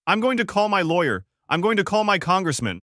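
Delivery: high, loud shrieking threats. A narrator's reading. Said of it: "she shrieked".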